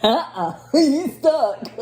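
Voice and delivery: slow, laughing tease; laughing, slow "you stuck" to husband. Real, not acted. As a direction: tease him through laughter.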